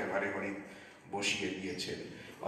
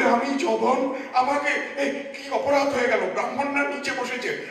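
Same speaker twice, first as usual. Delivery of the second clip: anxious protest; 7 semitones higher than usual. Retold or imitated. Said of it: "বলে".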